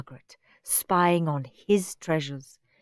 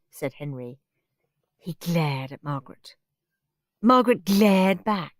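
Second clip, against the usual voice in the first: ordinary delivery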